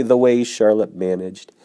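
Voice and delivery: usual speaking voice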